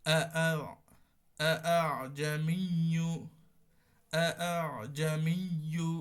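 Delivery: first আ full, second আ softened and joined to the আইন; tashil recitation. Shown as a demonstration. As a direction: recite জামিয়ু with the second hamza softened, loud and clear. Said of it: "দ্বিতীয়টা একটু নরম করে আইনের সাথে লাগানো".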